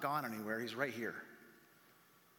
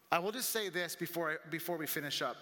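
ordinary talk, nothing marked

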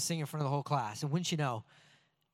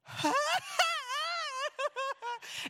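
very high, drawn-out wavering wail; hideous singing attempt. Nervous kid opens his mouth to sing, hideous wail. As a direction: squeal out a sustained mock sob. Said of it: "this is what came out".

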